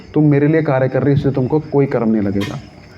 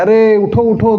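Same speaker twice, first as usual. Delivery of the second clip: higher-pitched mock cry of alarm; imitated shouting; 6 semitones higher, 3 dB louder, slower than usual. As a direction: call out urgently as the onlookers would. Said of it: "चिल्ला रहे हैं". (चिल्लाने की नकल, ऊँची आवाज़ में)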